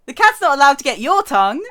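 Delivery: loud, fast playful scold; mock scolding. Real, not acted. Streamer scolding the game character about the cat, loud and fast. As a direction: call it out like a mock telling-off.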